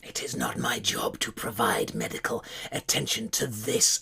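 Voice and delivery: cold whisper of a demonic guard; spider guard whisper. Acted. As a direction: dismiss the prisoner in a whisper. GM voices the Dryder guard.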